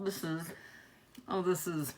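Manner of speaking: very soft, voice catching, nearly breaking; fighting tears over her late dog; holding back tears. Real, not acted. Unboxing socks of her dead dog, voice catching softly. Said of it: "try not to get emotional".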